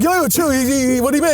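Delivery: high, hammed-up calming down; mock agitation. Group chat member calming others, high mock agitation. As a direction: play a flustered guy defusing a row. Riffing the Goodfellas confrontation.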